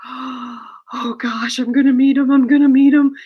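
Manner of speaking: high, building fangirl excitement; acted starstruck squeal; giddy anticipation. Acts giddy nerves before meeting idol.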